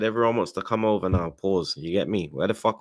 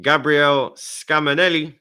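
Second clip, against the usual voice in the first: slow, dramatic headline voice; news headline read; 4 semitones higher, slower than usual. Acted.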